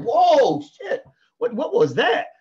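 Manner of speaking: high startled yelp with a laugh; startled whoa. Retold or imitated. Hit by a curveball, high startled yelp. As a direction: caught off guard by a curveball. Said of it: "You're like".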